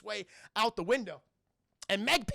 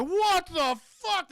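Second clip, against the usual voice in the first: high, slow, loud stunned exclamation; shocked cry; 11 semitones higher, 8 dB louder, much slower than usual. Retold or imitated. Doctor and Chad see the dissolved body, shocked cry. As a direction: drag it out in shock.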